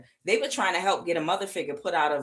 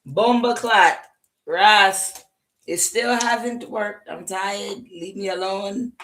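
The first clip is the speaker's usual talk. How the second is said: put-on weary Jamaican accent, a little higher at the start; tired Jamaican; 3 semitones higher, 4 dB louder, slower than usual. Reader voicing the tired Jamaican, weary Jamaican accent. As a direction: play a fed-up Jamaican accent. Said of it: "this Jamaican is tired".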